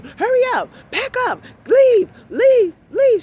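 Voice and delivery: high, loud shout of hurry up and leave; staff yelling. Retold or imitated. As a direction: yell at them to clear out. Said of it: "the people start yelling at you".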